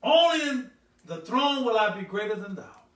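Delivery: big pulpit voice; full-force preaching. Acted. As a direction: proclaim the verse to the congregation. Scripture proclaimed at full force.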